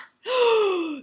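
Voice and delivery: sharp high startled gasp; mother bear gasping in shock; loud gasp. A narrator's reading. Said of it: "She cried".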